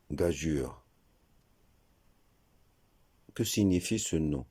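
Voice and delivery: usual speaking voice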